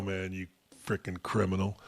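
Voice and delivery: quiet contemptuous aside; muttered insult. Real, not acted. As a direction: grumble it almost inaudibly.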